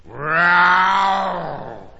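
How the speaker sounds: long, loud monster growl; drawn-out fearsome roar; terrible roar. Acted. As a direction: roar high and loud like a giant beast. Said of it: "they heard a terrible roar".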